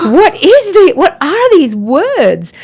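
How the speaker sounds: loud, very high, incredulous reaction; acted bewilderment. Newcomer to prayer baffled by the words, loud incredulous. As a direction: retell your astonishment, stretching out the question.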